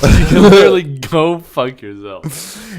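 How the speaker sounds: laughing loudly through the line. Real, not acted. Friend repeats the punchline while laughing loudly.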